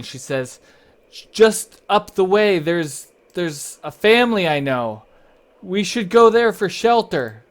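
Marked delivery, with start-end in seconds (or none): 1.3-7.4 raised voice, higher, calling over wind; acted shouting. Kira telling the party of nearby shelter, shouting. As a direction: shout it to companions in a storm. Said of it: "she's, like, shouting".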